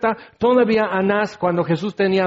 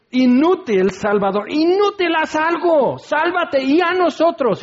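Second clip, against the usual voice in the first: gritado mucho más agudo, con esfuerzo de agonía; agonized strained cry; 9 semitones higher than usual. Acted. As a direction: jadea y grita desde la cruz. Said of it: "agonizando el ladrón". (el ladrón agonizante grita a Jesús, con voz forzada y aguda)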